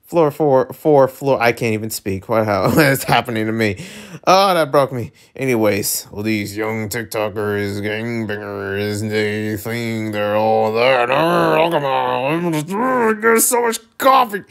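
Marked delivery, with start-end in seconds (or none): 6.1-14.4 grumbling character rant. Acted. Grumbling character rant builds to outburst.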